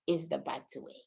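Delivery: soft, low African accent. Shown as a demonstration. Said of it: "in my African accent".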